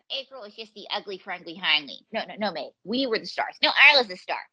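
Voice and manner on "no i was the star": loud, indignant claim to stardom; insistent correction. Acted. Performer squabbling with partner over billing, insistent correction. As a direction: cut in louder, insisting on yourself. Bickering over who was star.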